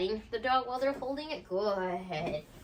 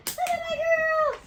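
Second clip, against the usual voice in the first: much higher, louder, slow delighted shout; thrilled dog-mom squeal; squealing praise; 7 dB louder, slower than usual. Real, not acted. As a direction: gush over the puppy at full pitch. Cheering dog during toy play.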